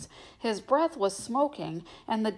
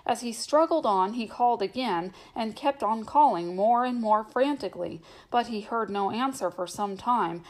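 ordinary delivery

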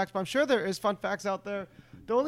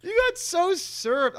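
teasing; 9 semitones higher, much slower than usual. Real, not acted. Teasing a friend.

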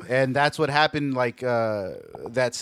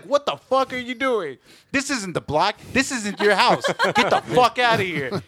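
raised-pitch retold telling-off; angry confrontation; 6 semitones higher than usual. Garage owner walking up on a tagger, angrily telling him off. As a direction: order an intruder off your turf.

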